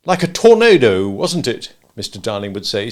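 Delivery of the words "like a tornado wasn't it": loud, mocking himself; sneering at his own outburst; self-scorning. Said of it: "scorning himself".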